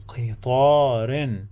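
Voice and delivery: slow, with the long vowel held; drawn-out teaching pronunciation; slow model word. Shown as a demonstration. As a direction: draw the word out syllable by syllable. Teacher models word slowly, then naturally.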